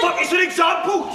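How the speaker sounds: loud, high protest; exasperated shout. Acted. Heated quarrel over nationality.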